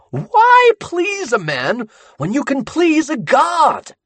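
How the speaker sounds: high, loud, slow zealous proclamation; fanatic Sammy. Acted. Sammy exalting the ink god to the captives. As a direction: preach his god like a prophet.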